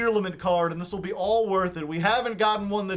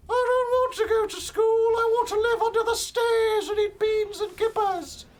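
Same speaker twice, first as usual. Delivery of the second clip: silly Harry Potter; 13 semitones higher than usual. Pretend. Streamer parodying Harry Potter for chat, high and squeaky. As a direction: squeal it like a kid wizard. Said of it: "Where did you learn this accent?".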